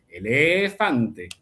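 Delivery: elefante said slowly, the syllable before fan held long; stretched stress. Shown as a demonstration. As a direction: drag out elefante to show the stress. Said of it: "fan es la sílaba tónica".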